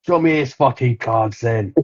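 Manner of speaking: put-on a London gangster's accent; a light London accent on the quote. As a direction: growl it like a London hard man. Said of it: "That's my London accent".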